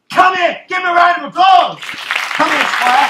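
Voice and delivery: calling out. Acted. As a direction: whip up applause like a stage host. Rallying the audience for volunteer.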